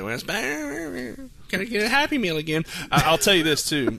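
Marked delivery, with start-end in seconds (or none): 0.0-2.6 mom voice. Retold or imitated. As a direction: drag it out like a teasing mom. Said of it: "my mom's like".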